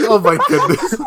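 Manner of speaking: loud, cracking up through the words; helpless amused exclamation; laughing. Real, not acted. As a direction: say it while bursting with laughter. Cracking up at the retold speech.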